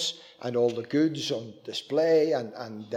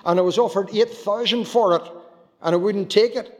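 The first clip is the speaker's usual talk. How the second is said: proud farmer; 7 semitones higher, 6 dB louder than usual. Retold or imitated. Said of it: "he says to me".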